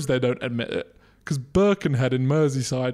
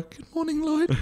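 put-on, much higher greeting; high-pitched female voice; 14 semitones higher than usual. Shown as a demonstration. Host asks which voice a friend prefers, high-pitched. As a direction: lift the greeting way up, like a mock female voice. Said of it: "a female with a high-pitched voice".

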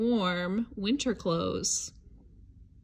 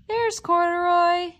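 higher and louder, a happy gasp of discovery; excited find; 7 semitones higher, 6 dB louder than usual. Acted. Finding Corduroy for the little listener, thrilled. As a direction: reveal him like a treasure.

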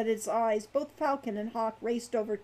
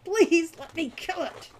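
slow, raised, strained plea; through clenched teeth; 7 semitones higher, 6 dB louder than usual. A narrator's reading. Hawk begging to kill the kobold, teeth clenched. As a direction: beg with anger behind clenched teeth. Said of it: "he said between clenched teeth".

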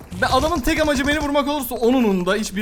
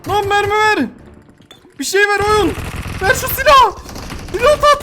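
high, loud panicked shouts; frantic yelling; 9 semitones higher, 4 dB louder, slower than usual. Real, not acted. Streamer out of bullets yelling at enemies, panicked shouting. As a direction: scream at the screen, out of ammo.

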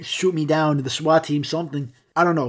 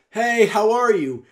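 slow, high, carrying greeting; drawn-out theatrical projection; projected voice; 7 semitones higher, 4 dB louder, slower than usual. Shown as a demonstration. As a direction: call it from far back without yelling. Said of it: "You project your voice".

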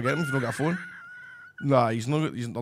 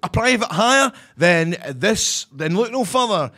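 higher-pitched radio ad read; 4 semitones higher than usual. Pretend. Co-host plugs the taxi sponsor on request, radio voice. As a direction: sell it like a radio presenter. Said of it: "do it in your radio voice".

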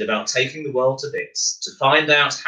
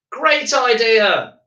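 much higher, slow cheer of agreement; crowd agreeing; 12 semitones higher, 3 dB louder, much slower than usual. Acted. The crowd agrees to send Miles on Concorde, slow high cheer. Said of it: "they all agreed".

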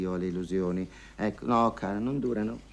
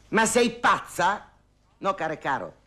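outraged disbelief; 11 semitones higher, 6 dB louder than usual. Acted. Outraged at Erminia's price.

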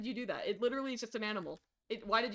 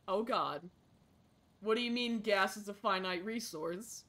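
normal talking voice

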